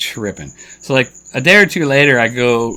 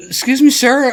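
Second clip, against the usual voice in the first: concerned shopper; 14 semitones higher, 5 dB louder than usual. Retold or imitated. Shoppers voiced in story.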